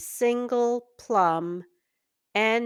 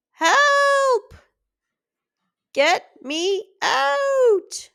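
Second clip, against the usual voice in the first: high, loud panicked cry, slowing on get me out; a trapped spider shrieking to be freed; desperate high wail for help; 6 dB louder, slower than usual. A narrator's reading. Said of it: "he cried".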